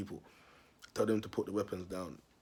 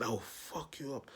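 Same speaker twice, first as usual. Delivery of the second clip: softer, slow, menacing stepdad; quiet slow threat; 4 semitones higher, 6 dB quieter, slower than usual. Retold or imitated. The stepdad warning the kid, soft, slow and menacing. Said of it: "He said".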